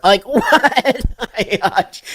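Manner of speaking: incredulous question through laughter; real laugh bursting into the words; laughing disbelief. Real, not acted. Laughing at the firing story.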